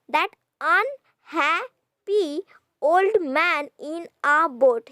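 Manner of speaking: put-on "That unhappy old man in a boat" slow, "unhappy" split in three; syllable by syllable. Shown as a demonstration. Teacher counting syllables, "That unhappy old man in a boat" slowly separated. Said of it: "Here we have nine syllables".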